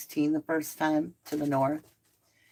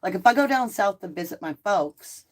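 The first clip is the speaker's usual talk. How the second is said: ordinary talk, nothing marked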